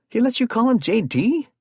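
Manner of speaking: high, loud incredulous yell; loud astonished shout. A narrator's reading. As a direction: burst out in disbelief. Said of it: "Jared yelled in astonishment".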